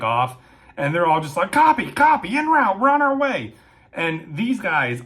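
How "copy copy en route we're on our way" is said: high, loud frantic radio call; panicked excitement. Retold or imitated. Patrolmen radioing back once they believe it, panicky and excited. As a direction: imitate patrolmen calling in, excited and scared. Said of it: "excitement mixed with panic, mixed with fear".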